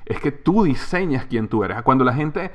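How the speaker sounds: normal talking voice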